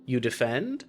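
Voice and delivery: ordinary talk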